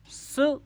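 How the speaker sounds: स alone as a high, short "su"; isolated syllable. Shown as a demonstration. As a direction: cut off स as the first beat of school. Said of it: "यहाँ पे हमारी आवाज़ टूटी". (स अलग से, ऊँचे सुर में "सु")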